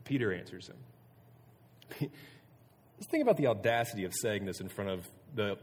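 normal talking voice

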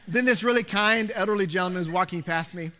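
usual speaking voice

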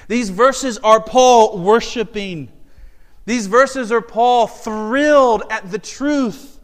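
slow, loud, high exultation; preaching at full force. Acted. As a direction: proclaim it with thrill.

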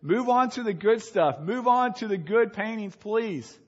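loud, high, impatient complaint; impatient crowd. Retold or imitated. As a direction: demand they move on, annoyed. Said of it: "They're getting angry. They're saying".

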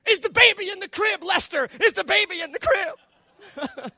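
high, loud frantic question; panicked shouting. Acted. Parent yelling to spouse about the baby, mock panic.

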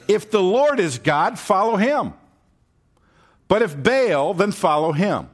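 high, loud preaching call; Elijah's ringing challenge. Acted. Elijah calling the people to choose, loud and high. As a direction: proclaim it to the crowd like Elijah.

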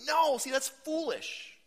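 very high, loud, slow scoff; high, drawn-out mock scoff; hammed-up dismissal. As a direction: brush it off like a shocked scold. Exaggerated dismissal.

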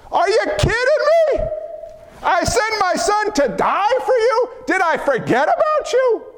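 high, incredulous near-shout as God; God roaring back at the doubter; fired-up voice of God. Acted. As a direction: cry out in God's disbelief. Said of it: "I'm a little fired up".

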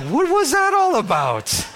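drawn-out, high-pitched what-was-that; incredulous, high and slow; high, slow disbelief. Retold or imitated. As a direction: stretch it out high and slow, stunned. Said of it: "I was just like".

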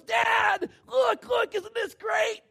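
put-on very high, loud, thrilled little boy calling out; a kid yelling to his dad from the cliff edge; excited child. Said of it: "he turns around and he goes".

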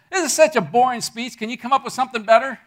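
a stranger scoffing at the speech; mock heckler. Stranger in the third row heckling the speaker, loud and laughing.

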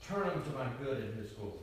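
ordinary talk

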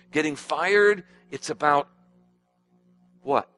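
ordinary delivery

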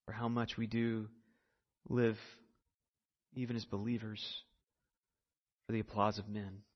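soft, low, earnest prayer to the Lord; closing prayer. Real, not acted. Pastor leads the church in hushed prayer. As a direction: pray quietly on behalf of the congregation.

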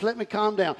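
ordinary delivery

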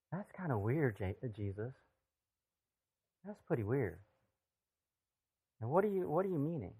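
softly voiced puzzlement; puzzled wondering. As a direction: wonder about it quietly, half to yourself. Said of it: "while you're wondering that".

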